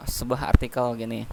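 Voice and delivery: usual speaking voice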